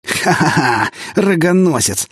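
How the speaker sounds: mocking laugh, then a high jeer; villain laughs and jeers at the prince, gloating. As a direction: cackle over the cuckold.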